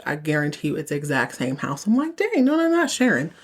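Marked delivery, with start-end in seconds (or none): 2.2-3.3 high, mock dismay; dismayed surprise. Real, not acted. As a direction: protest in playful disbelief. Reacting to the show.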